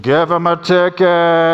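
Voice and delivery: loud, drawn-out mock heckle; hammed-up passer-by shouting to the police; heckling passer-by. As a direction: call it out slowly like a jeering bystander. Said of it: "another guy walks by".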